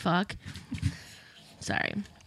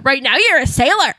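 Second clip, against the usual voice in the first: higher, louder mock scolding; comic bit voice; 4 semitones higher, 7 dB louder than usual. Host replaying greatest hits, comic bit voice. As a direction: belt out the punchline.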